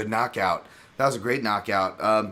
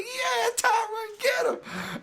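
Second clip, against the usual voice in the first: very high, slow cheering cry; mom cheering her son; much slower than usual. Retold or imitated. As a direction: scream him on from ringside. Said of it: "they're showing her jumping".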